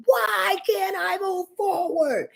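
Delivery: high, slow, strained inner cry; pleading inner voice, pitched high and drawn out; internal scream. Acted. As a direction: let the trapped voice inside plead upward. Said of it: "Is the internal scream".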